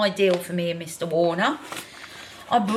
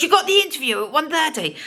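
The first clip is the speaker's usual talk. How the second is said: nagging inner voice; 7 semitones higher, faster than usual. Retold or imitated. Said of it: "my mind just goes".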